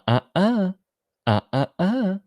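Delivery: high wagging uh uh uh; mock warning from the movie, sung high; Jurassic Park quote. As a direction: scold like the Jurassic Park computer. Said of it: "like Jurassic Park".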